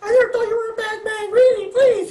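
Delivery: squeaky falsetto pleading woman; horrible female voice. Acted. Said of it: "Yes, I make horrible female voices".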